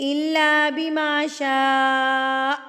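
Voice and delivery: slow recitation, شاء held very long; long madd. Shown as a demonstration. Teaching big madd, شاء drawn far out. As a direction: stretch "shaa" in شاء for four beats. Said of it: "چار حرکات کے برابر کھینچ".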